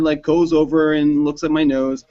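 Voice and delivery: normal talking voice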